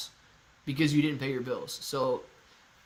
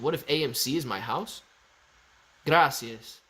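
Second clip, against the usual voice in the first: usual speaking voice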